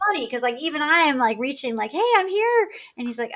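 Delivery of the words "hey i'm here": proud announcement. Retold or imitated. Announcing she has arrived.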